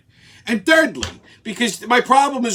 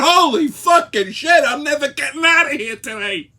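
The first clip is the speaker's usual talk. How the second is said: exasperated; 7 semitones higher than usual. Retold or imitated. Retells his own exasperated outburst.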